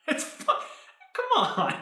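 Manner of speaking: high, slow giggling protest; laughing protest. Real, not acted. Host amused by his own slip, laughing plea. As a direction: plead through laughter.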